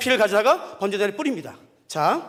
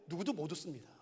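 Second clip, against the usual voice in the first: 누구도 못 웃습니다 said much softer, almost under the breath; hushed statement; 8 semitones lower, 17 dB quieter than usual. Shown as a demonstration. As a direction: drop right down and let it land. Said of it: "근데 아세요?". (아주 작게 가라앉힌 목소리로)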